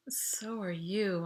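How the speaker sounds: soft, slow, barely said; a shy tender aside; unspoken tender. A narrator's reading. Boy secretly wanting to tell his date she's beautiful, soft and slow. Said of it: "I wanted to say".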